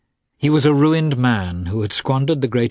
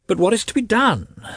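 high-pitched, guilty question; helpless, higher-pitched guilt; 12 semitones higher than usual. A narrator's reading. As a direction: plead guiltily in a raised voice. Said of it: "said Lievin guiltily".

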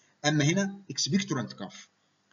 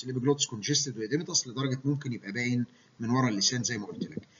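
ordinary delivery

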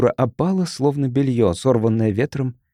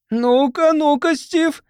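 shouting; 13 semitones higher, slower than usual. Acted. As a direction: call out at Steve demanding answers. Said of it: "закричал".

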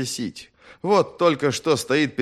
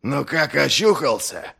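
raspy mocking warrior; 5 semitones higher than usual. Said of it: "Резко, хрипло, точно каркающий ворон".

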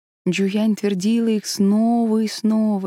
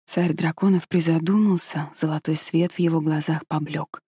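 plain reading voice

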